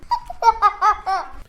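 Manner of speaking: a short bright burst of laughter; amused giggle. Real, not acted. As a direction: laugh at grandpa's silly ending. Girl laughs at grandpa's tale.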